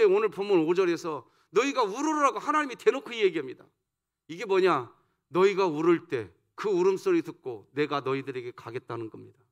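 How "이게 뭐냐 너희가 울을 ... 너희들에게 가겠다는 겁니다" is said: ordinary talk, nothing marked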